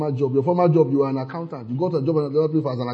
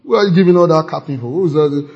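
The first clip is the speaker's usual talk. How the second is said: normal talking voice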